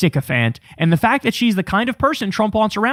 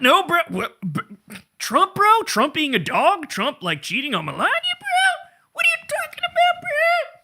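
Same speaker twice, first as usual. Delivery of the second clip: rising into a squeaky high whiny bro voice; mock disbelief; 13 semitones higher, 3 dB quieter than usual. Mocking loyalists pretending Trump would never cheat, in a squeaky mock bro voice. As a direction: play a shocked dudebro, pitch climbing way up.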